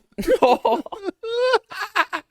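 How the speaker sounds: high burst of laughter. Real, not acted. Laughing at own Borat joke.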